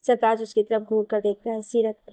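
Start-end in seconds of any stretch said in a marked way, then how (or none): none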